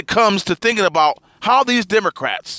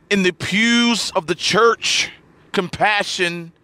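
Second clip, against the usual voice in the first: ordinary talk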